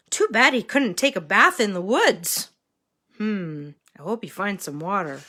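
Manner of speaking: ordinary delivery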